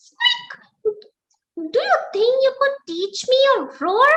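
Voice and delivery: high, fast squeaky mouse voice; brave little mouse. Acted. Mouse waking the sleeping lion, high squeak. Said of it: "He gathered courage and said".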